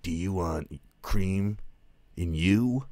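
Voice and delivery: mock action hero pun, slowed right down; cheesy catchphrase. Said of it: "everything he says is a catchphrase".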